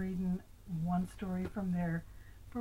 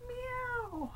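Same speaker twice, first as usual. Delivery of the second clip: high cat meow. Pretend. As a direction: meow like a sad little kitten. Grandma voicing the unhappy cat.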